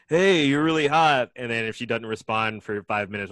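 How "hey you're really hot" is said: mock flirt. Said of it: "nice guys would be like".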